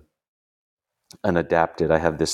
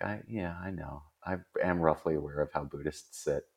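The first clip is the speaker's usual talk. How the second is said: much softer, a private mutter; muttered inner reply; 3 semitones lower, 8 dB quieter than usual. Retold or imitated. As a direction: say it under your breath. I didn't say this to him.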